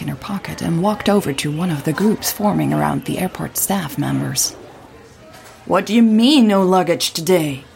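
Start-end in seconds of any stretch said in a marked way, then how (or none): 5.6-7.6 louder, incredulous passenger; outraged, pushing up in pitch and volume; indignant demand. Acted. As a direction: demand an answer from airline staff. Angry passenger confronts airport staff.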